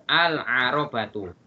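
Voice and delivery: "Al-Arobatu" slowly with the L sounded; slow model reading of "Al-Arobatu"; alif lam pronounced. Shown as a demonstration. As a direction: say "Al-Arobatu" with a clear Al, unhurried. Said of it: "maka alif lamnya terbaca".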